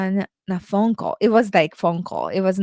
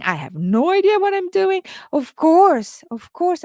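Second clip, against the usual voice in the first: much higher, fast mock surrender; mock defeatist; 10 semitones higher, 4 dB louder than usual. Imagining giving in to the doubting coach, high mock whine. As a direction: toss it off in a high put-on whine.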